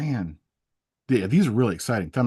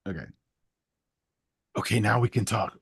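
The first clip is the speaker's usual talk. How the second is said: normal talking voice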